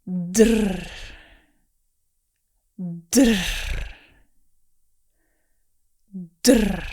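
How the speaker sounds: Drr with a trilled rolled r. Shown as a demonstration. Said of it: "I'm going to let the sound loosen up".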